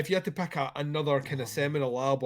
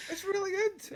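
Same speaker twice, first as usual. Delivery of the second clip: very high put-on granny voice; 4 dB quieter than usual. Friend repeating the joke to the group, high gran voice. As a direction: play a high-pitched Scottish grandma. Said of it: "Sounds like my gran a wee bit".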